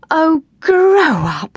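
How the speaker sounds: high, slow, loud scoff; exasperated retort. A narrator's reading. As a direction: call back over the shoulder. TJ retorts to Will.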